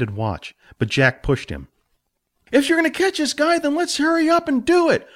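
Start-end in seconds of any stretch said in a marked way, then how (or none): 2.5-5.1 high impatient push; impatient mentor. Acted. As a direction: hurry him up. Jack pushes Billy on.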